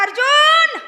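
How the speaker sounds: high, loud divine call; Krishna calling. Acted. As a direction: call out his name like a god. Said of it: "भगवान म्हणता". (भगवान कृष्ण मोठ्याने अर्जुनाला हाक मारतात)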